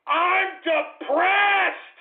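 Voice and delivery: high, very slow yell; anguished outburst. Real, not acted. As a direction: wail it, stretching the words. Wailing outburst in rant.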